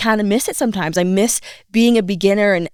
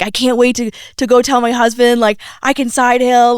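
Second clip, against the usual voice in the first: higher, louder eager boast; imitating proud excitement; 5 semitones higher, 5 dB louder than usual. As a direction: quote an excited student bragging. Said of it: "she's like".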